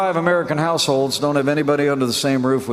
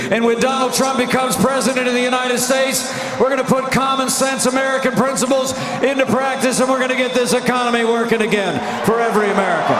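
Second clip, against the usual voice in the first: rally speech; 7 semitones higher than usual. Real, not acted. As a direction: rouse the crowd, voice raised.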